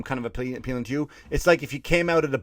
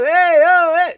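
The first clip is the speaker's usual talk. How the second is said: high, loud hammed-up street hawker calling; Italian waiter call; 10 dB louder, slower than usual. Little Italy tout hailing passing tourists, loud hammed-up call. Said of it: "Walking down the street".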